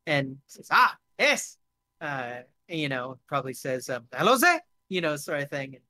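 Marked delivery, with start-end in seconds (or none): 0.7-1.6 high, loud German-French exclamation; eager newcomer, light German-French accent. Acted. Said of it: "he's switching between German and French". 4.1-4.6 high, loud Franco-German greeting; chirpy rising hello, German-French accent. Acted. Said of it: "he's switching between German and French".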